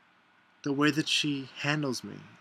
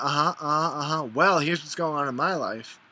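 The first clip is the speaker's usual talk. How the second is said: louder, higher mock listening, then cutting to oneself; dismissive uh-huh; 4 semitones higher, 6 dB louder than usual. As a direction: imitate a self-absorbed friend brushing you off. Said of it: "Or they do the".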